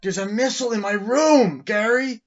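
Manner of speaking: high, loud mock-outraged yell; big hammed-up shriek of alarm; retold outraged shout. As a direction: holler it up high, imitating a scandalized character. Acted outburst in retold film.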